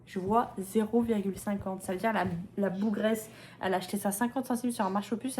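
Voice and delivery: ordinary talk, nothing marked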